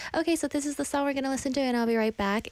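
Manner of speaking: fast, rushed radio announcement. Shown as a demonstration. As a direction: rattle it off to get to the song. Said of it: "this is what I would do".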